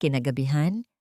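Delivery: usual speaking voice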